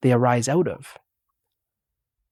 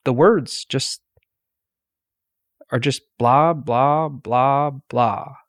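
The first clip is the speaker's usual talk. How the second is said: dismissive blah blah; slower than usual. Retold or imitated. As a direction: brush the words aside with bouncing blahs. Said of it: "I said".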